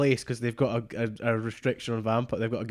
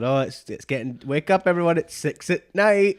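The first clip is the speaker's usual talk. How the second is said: vampire waking everyone; 5 semitones higher, 5 dB louder than usual. Retold or imitated. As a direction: announce it to the whole house, louder and higher. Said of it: "And he gets up and goes".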